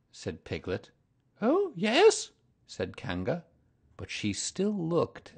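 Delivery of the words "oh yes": very high, louder kangaroo mother; sing-song motherly greeting. A narrator's reading. Kanga greets Pooh, very high and motherly.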